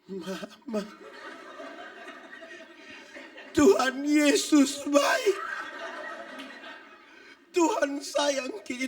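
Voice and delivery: slow, loud, laughing plea of a husband on his deathbed; mock dying husband. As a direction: ham up a sick man suddenly tender to his wife. Dying husband pleads tenderly to wife.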